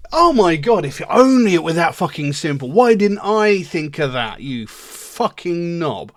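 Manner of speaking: loud, high mock amazement, slowing into an insult; exaggerated exasperated sarcasm; scathing sarcasm. Sarcastic retort to "cheer up.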